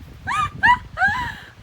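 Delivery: high burst of laughing; loud laughter. Real, not acted. Cracking up in the cave.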